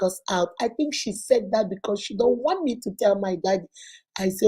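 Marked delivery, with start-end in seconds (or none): none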